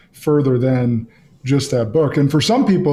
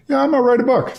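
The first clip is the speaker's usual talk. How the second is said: high mock voice; 11 semitones higher, much faster than usual. Host voices guest's imagined thought.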